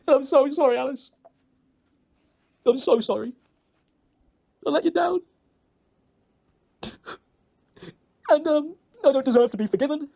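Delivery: high, upset, halting apology; real heartfelt remorse in a high voice; distraught apology. Real, not acted. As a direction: plead sorry, pitch climbing with emotion. Emotional apology to someone let down.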